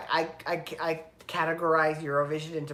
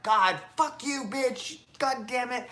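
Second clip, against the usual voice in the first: mock angry outburst; 6 semitones higher than usual. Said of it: "I was so pissed".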